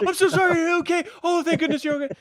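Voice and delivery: a hammed-up, high fake concern; mock fake apology. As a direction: gush a sorry like a guilty cover-up. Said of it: "play it off like".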